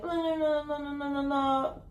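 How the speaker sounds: high, droning whine; mock nagging. Venting about life to close people, mock nagging. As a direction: run the words together in a high mock whine. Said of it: "nagging about our life".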